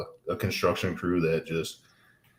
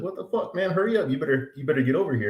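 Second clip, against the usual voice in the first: calling back mid-race; 8 semitones higher, faster than usual. Retold or imitated. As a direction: taunt him to hurry up, shouting high. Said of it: "And I was like".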